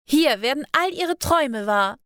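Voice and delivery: high, showy call to an imaginary crowd; mock announcement. A narrator's reading. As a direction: announce it like a showman. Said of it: "sprach jetzt auch sie lautstark". (lautstark und theatralisch an ein erfundenes Publikum)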